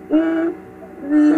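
Uy with lips pursed as if whistling; rounded Uy. Shown as a demonstration. Teacher models ü as Uy, lips held round. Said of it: "giữ nguyên khẩu hình".

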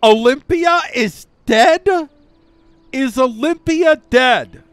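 loud, high, stunned disbelief; shocked shout. Real, not acted. Player hearing his favorite daughter died, shocked shout. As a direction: shout it out, can't believe she's gone.

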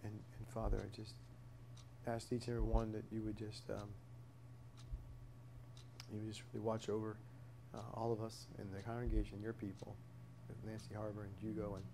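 earnest spoken prayer, soft and halting. Real, not acted. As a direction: pray it quietly, pausing between names. Closing prayer before Bible study.

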